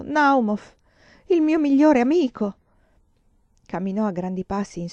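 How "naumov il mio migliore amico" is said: high, loud cry of anguish, acted; almost sobbing. A narrator's reading. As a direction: cry out as if close to a sob. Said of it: "la sua voce era quasi un singhiozzo". (grido alto e addolorato, quasi un singhiozzo)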